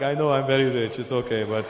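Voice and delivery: lightly put-on Saudi accent; a hint of a Saudi accent, modest shrug in the voice; Saudi guy. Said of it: "Saudi guy. He, he's like".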